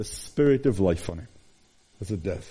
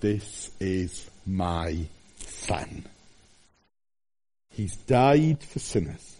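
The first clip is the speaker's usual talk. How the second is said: very slow, soft, low voice of God; tender Father's voice; 3 semitones lower, 3 dB quieter, slower than usual. Acted. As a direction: let each word land slowly and gently. God speaks at the resurrection.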